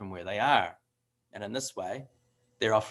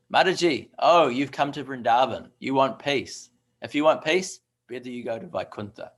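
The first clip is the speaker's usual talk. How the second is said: cheeky boatman retort; 3 semitones higher, 8 dB louder than usual. Retold or imitated. Boatman teasing the woman who wants peace, loud and cheeky. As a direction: fire back at her with a grin. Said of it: "And he said".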